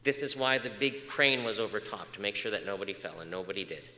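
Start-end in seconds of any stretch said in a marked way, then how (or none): none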